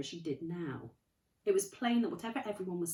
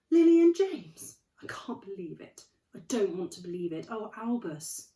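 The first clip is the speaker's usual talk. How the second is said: anguished cry; 3 semitones higher, 11 dB louder than usual. Acted. McGonagall shocked grief.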